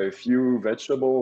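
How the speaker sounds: ordinary talk, nothing marked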